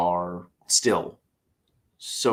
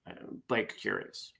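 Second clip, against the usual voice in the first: much softer, weary repeat of the punchline; tired punchline; 11 dB quieter than usual. Real, not acted. Tired vlogger repeating the punchline, much softer. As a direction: mumble it again, worn out. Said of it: "I'm too tired to fix it".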